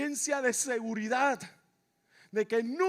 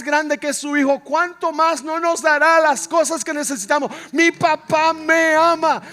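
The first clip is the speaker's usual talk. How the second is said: building to a sustained preacher's shout; full-force preaching; 4 semitones higher, 15 dB louder than usual. Real, not acted. Preacher proclaiming nothing stops God's love, full-force shouting. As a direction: shout it out relentlessly to the congregation.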